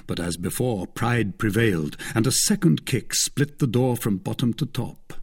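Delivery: plain reading voice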